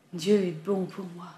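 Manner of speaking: slow, sighing line; sighed. A narrator's reading. Homeless man thanking God, said on a slow sigh. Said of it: "soupira-t-il".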